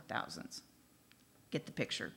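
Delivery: a whispered thousands. Shown as a demonstration. Contrasting millions and thousands.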